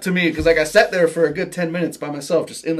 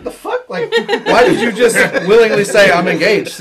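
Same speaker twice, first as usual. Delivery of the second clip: high laughing disbelief; incredulous; 6 semitones higher, 8 dB louder than usual. Retold or imitated. Friends reacting to his odd reply, laughing and incredulous.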